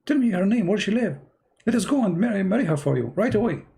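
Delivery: high, eager, overjoyed father voice; excited father. Retold or imitated. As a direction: bubble over with joy as the delighted dad. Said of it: "the father was so excited, very, very happy".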